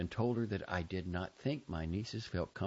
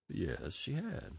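slow, softer, smooth reassurance; soothing professional voice; 6 dB quieter, slower than usual. Retold or imitated. Uncle answering the sister, slow soothing specialist tone. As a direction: lull her with calm, corporate assurance. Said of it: "in a soothing asset management specialist voice".

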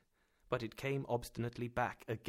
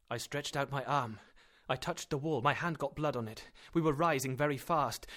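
ordinary delivery

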